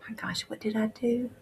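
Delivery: whispered, aghast at his own mistake; soft whispered regret; stricken whisper. Retold or imitated. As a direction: breathe it out in shock. Man realizes what he has done.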